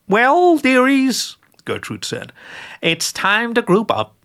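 put-on a doting old witch sharing her recipe; sweet old witch. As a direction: croon it like a kindly granny who bakes angels. Gertrude's voice in story.

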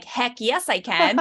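play-acted excited yes; eager kid. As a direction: jump at the job like a proud kid. Retold kid eagerly accepts the job.

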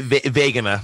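"vagina" said as "VAY-guh-nuh"; hard G and long AY in "vagina", stressed at the front; comic mispronunciation. Shown as a demonstration. Asked how the friend would say it, comically mispronounced "vagina" as VAY-guh-nuh. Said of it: "How do they pronounce vagina?".

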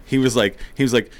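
ordinary delivery

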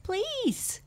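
very high, pleading squeak; crying-out mouse. A narrator's reading. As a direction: beg like a tiny frightened mouse. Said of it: "cried Mouse".

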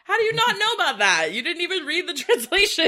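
high-pitched indignant protest, laughing a little; real but playful exasperation; incredulous challenge. Real, not acted. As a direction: challenge them in amused disbelief. Friendly argument, incredulous challenge.

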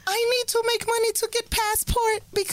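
high pleading shout quoting the masseuse; mock plea. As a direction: blurt it out high like a desperate worker.

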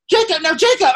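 loud high-pitched call at the character; high yell. Retold or imitated. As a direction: yell his name at the screen. Still yelling at the character.

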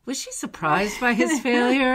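amused question with a laugh breaking in. Real, not acted. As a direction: chuckle through the question. Laughs while asking co-host.

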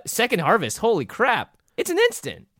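high, loud amazed exclaim; excited. Real, not acted. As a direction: burst out in delighted disbelief. Excited reaction to card.

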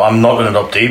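ordinary talk, nothing marked

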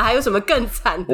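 ordinary delivery

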